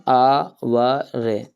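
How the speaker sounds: आवर stretched out very slowly, silent h; pronunciation demo. Shown as a demonstration. Teacher showing students how to say आवर, slow and drawn out. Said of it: "इसको पढ़ते क्या अपन".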